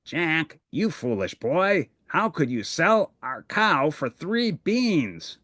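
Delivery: Bert acting a cross parent on stage; scolding mother. Bert plays Jack's mother in play.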